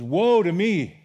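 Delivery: a loud lament of ruin; crying out. Acted. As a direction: cry out like a man undone before God. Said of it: "And then Isaiah cried".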